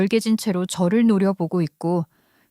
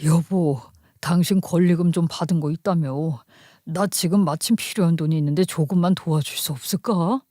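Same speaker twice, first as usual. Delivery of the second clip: lower-pitched husband, casually asking; husband's voice; 4 semitones lower than usual. Acted. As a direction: ask a favour in a put-on lower voice. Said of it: "남편 놈이 연락을 해왔다는 거예요". (남편 흉내로 낮게 부탁하듯)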